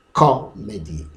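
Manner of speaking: "comedy" with a loud first syllable, then falling away; first-syllable stress. Shown as a demonstration. Teacher showing where the stress is, first syllable punched. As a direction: hit the CO of "comedy" high, drop the rest. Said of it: "goes high pitch".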